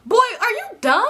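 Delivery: high, loud mock disbelief; incredulous put-down. Telling off the guy in the car, high incredulous scoff. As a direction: sneer it at him like he's stupid.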